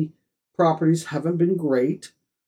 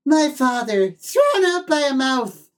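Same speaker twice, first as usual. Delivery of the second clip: high lisping cartoon cat, dramatically ashamed; Sylvester voice; 10 semitones higher than usual. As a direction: wail it like a shamed cartoon cat. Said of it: "where he says".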